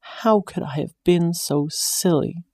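mildly tearful self-reproach; a touch of crying. A narrator's reading. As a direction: let a little cry through. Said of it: "Beth lets herself cry".

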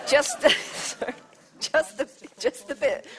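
laughing. Real, not acted. Laughs while explaining.

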